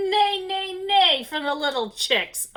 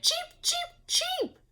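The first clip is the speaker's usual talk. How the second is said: very high tiny chick cheeps; cheeping chicks; 4 dB quieter, slower than usual. A narrator's reading. Storyteller voices the chicks for kids. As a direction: squeak it like baby chicks.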